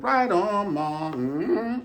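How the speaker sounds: high and bright sass; a sassy aside to the crowd; sassy high exclamation. Acted. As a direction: pop it up high with attitude.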